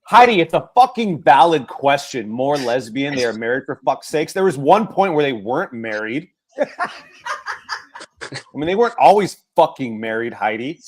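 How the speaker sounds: loud laughing rant at a friend; Savan rant. Retold or imitated. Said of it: "Did I sound like Savan right there?".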